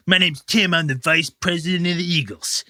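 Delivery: a hint of a tipsy club vice president; lightly voiced drunk official. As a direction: pitch the club to the boys, lightly slurred. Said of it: "He goes".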